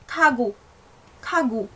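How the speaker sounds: KAGU, lightly aspirated k then voiced g, falling; correct Korean kagu. Shown as a demonstration. Teacher models the right word, KAGU with airy k and falling end. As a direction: puff lightly on KA, voice the GU, let it fall. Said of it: "as narinig nyo siya".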